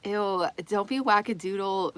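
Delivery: ordinary talk, nothing marked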